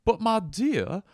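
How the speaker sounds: high, airs-and-graces Southern gentry voice; hammed-up Southern aristocrat with dropped r's; Southern aristocratic accent. Shown as a demonstration. Aristocrat pondering where to find a hamburger, lofty Southern accent. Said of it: "Aristocratic accent is".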